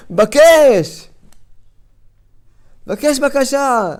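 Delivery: very slow, stretched and loud; drawn-out urging. Acted. Preacher urging the congregation to ask now, slow and pressing. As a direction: stretch every sound, press them to ask.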